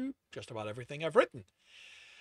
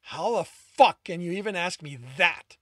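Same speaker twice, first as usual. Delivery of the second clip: louder, raw furious retort; angry; 3 semitones higher, 9 dB louder than usual. A narrator's reading. Tristan snaps at Alex in raised anger. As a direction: spit it back in anger. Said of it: "anger laced his word".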